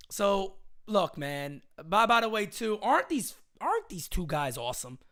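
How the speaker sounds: ordinary talk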